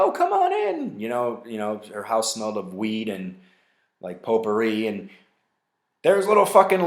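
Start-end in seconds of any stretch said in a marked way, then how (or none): 0.0-1.0 much higher, sing-song welcome; lightly voiced hippie lady; welcoming hippie lady. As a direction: invite them in sweetly.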